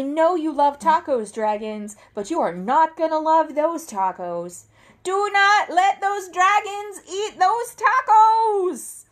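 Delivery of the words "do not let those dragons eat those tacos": usual speaking voice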